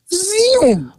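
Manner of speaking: high zoom; imitated zooming car. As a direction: zip it off like a toy car vanishing. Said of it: "the car".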